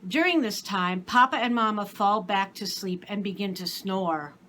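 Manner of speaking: ordinary delivery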